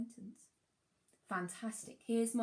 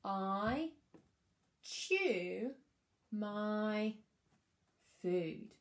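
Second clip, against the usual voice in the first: "I chew my food" read one word at a time, louder; 3 dB louder, much slower than usual. Shown as a demonstration. Teacher reading her sentence aloud so the children can follow. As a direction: read each word separately for the class to join in. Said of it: "Are we ready to read it?".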